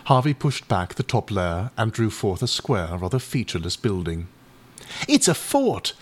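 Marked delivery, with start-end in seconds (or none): 5.1-5.9 excited exclaim. A narrator's reading. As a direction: burst out with the discovery like an excited boy. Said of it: "exclaimed Bertie".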